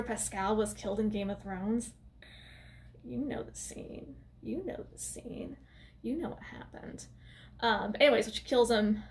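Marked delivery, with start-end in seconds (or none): 3.0-4.2 hushed aside. Real, not acted. First take of aside. 4.4-5.6 whisper. Real, not acted. Repeated aside, whispered. 6.0-7.1 hushed conspiratorial whisper; whispered aside to viewers. Real, not acted. Whispered aside about Game of Thrones.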